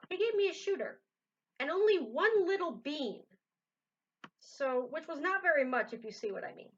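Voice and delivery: lively read-aloud voice for a picture book; story narration. A narrator's reading. Reader to viewers, reading a Seuss book aloud. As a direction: tell the rhyme with bounce.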